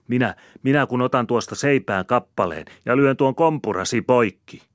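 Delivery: high, loud tirade; railing, combative wife; furious threat. A narrator's reading. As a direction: explode in outrage at him. Woman threatens man in quarrel.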